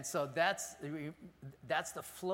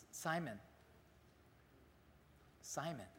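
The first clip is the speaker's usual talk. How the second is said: endearment; 13 dB quieter than usual. Shown as a demonstration. Jesus softly pleading with beloved Simon, yearning and tender. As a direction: say Simon, Simon softly as to a cherished child. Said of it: "it's one of endearment".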